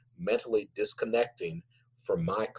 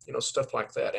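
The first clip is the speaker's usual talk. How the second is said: normal talking voice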